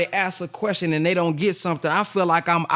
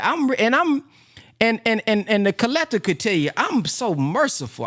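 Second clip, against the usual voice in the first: usual speaking voice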